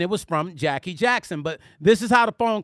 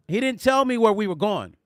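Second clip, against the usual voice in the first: normal talking voice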